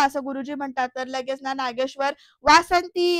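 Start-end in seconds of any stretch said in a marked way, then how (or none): 2.4-3.2 higher, louder call of a name; loud retold shout. Husband calling for his missing wife, loud shout. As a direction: imitate an angry master summoning someone. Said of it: "असं जोरात ओरडतात".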